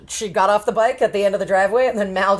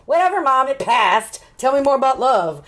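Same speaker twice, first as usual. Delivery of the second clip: higher, mock teenage brush-off; impatient daughter; 6 semitones higher than usual. Daughter dismisses mom's warning, lightly acted impatience. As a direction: wave mom away and press on.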